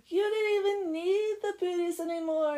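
high, loud gushing; swooning delight. Acted. Oksana throwing herself at the blacksmith, mock swooning. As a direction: gush it high, hammed-up.

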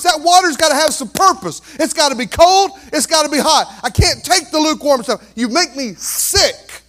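loud, high, driving sermon voice; fiery pulpit climax; preaching at full force. Acted.

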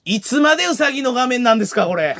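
high-pitched comic retort; hammed-up complaint on air; mock host protest. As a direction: protest like an exasperated TV emcee. Plays host protesting rabbit screen.

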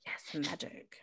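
soft, voiceless mock-mystical whisper; mysterious stage whisper. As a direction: whisper it like a magician. Playful stage whisper of magic.

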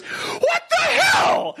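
very high, drawn-out yelp; mock outraged yell. Driver gets out after his car is punched, mock outraged yell. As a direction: imitate an outraged driver, stretched and squeaky.

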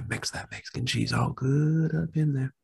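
breathy seductive whisper; sultry whisper. Acted.

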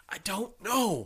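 high, slow hammed-up Irish brogue; mock Irish accent. Co-host unsure what to believe, mock Irish accent. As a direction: put on a drawn-out Irish lilt.

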